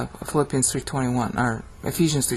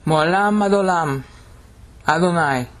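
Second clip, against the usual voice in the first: higher, sustained proclamation; thundering Hebrew; 3 semitones higher than usual. Shown as a demonstration. Teacher reciting a Sabbath worship phrase, thundering. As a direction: intone it as one long line. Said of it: "the thundering Hebrew".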